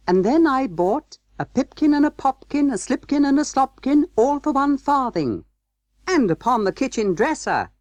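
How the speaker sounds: very high, cheeky mouse chant; rude little mice. A narrator's reading. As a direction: rattle off the rhyme as impudent tiny mice. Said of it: "added the rude little mice".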